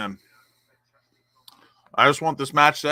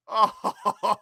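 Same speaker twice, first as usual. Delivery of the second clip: delighted laugh; 6 semitones higher than usual. Real, not acted. Laughing with delight after goal.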